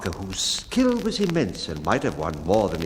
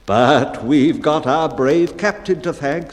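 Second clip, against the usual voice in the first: louder, slow, insistent protest; crew protesting; 5 semitones higher, 7 dB louder than usual. A narrator's reading. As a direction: protest loudly to the bellman. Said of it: "so the crew would protest".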